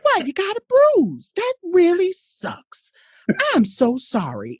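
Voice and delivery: high, slow, syrupy pity that does nothing; mock sympathy. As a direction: coo over the kid with empty sympathy. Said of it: "And the person goes".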